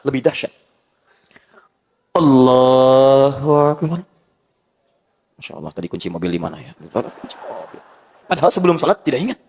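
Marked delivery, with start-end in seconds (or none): 2.2-4.0 drawn-out, chanted Allahu Akbar; slow prayer takbir. Shown as a demonstration. Preacher shows the congregation the opening of prayer, slow chanted takbir. 5.4-7.8 soft, muttered, half-whispered aside; mid-prayer murmur. Acted. Worshipper remembering lost car keys during prayer, soft mutter.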